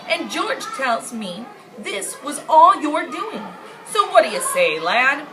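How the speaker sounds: a jolly king laughing as he asks; loud, chuckling royal voice; hearty king. Acted. King cheerfully invites the lad.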